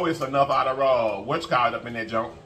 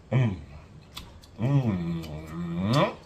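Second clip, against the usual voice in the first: normal talking voice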